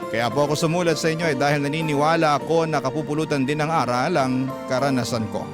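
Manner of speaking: usual speaking voice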